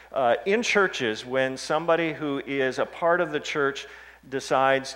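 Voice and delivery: loud, high, ringing pulpit voice; preaching at full force. Acted. Preacher to congregation on the lost sheep, booming and fervent. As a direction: drive the parables home from the pulpit.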